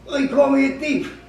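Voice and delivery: high, loud wounded protest; indignant whine. Retold or imitated. Thief whining to the party host, high indignant protest. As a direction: complain like the victim.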